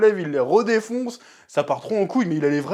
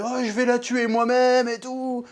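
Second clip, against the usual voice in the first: slow, higher-pitched mock fury; mock losing it; 5 semitones higher than usual. Retelling Alicent flying off the handle, mock enraged. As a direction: drag out the threat, pitched up. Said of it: "Alicent qui pète un câble".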